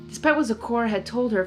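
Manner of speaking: ordinary delivery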